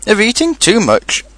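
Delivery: high-pitched, mildly stern reproof. A narrator's reading. Rabbit blaming Pooh's greed, stern. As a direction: deliver the verdict reprovingly. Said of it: "said Rabbit sternly".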